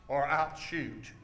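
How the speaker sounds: slow, low, softened warning; low hero's threat. Shown as a demonstration. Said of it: "Let's watch a little closer".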